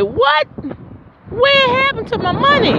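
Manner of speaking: high, squeaky outraged what; shocked disbelief. Retold or imitated. Retelling her tiny bonus check, high shocked squeal. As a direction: squeal it like the money vanished. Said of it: "I was like".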